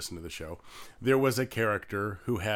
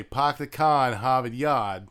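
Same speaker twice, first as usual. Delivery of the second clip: louder, R-dropping Boston accent; 2 semitones higher, 6 dB louder than usual. Shown as a demonstration. Teaching a Boston dialect phrase, Boston accent. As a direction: flatten every R away, Boston style. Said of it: "how do you get a Boston dialect".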